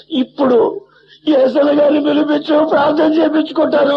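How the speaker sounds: put-on a boy begging through tears; tearful pleading. Boy sobbing as he begs the pastor to come, tearful pleading.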